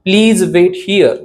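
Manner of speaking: very slow and clear; slow dictation. Shown as a demonstration. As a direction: model it slowly. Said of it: "कसं म्हणणार?". (अगदी हळू, स्पष्ट)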